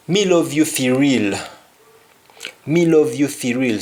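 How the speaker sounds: "Me love fi you real" in a Jamaican Patois lilt; a Patois line of devotion. Shown as a demonstration. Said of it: "we say".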